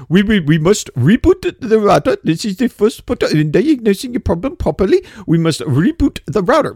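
put-on stubborn Indian-accented scripted agent. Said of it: "they got the accent".

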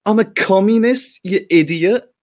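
Ash Sakar impression. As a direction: lampoon her as a squeaky, indignant activist. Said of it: "my impression would be something like".

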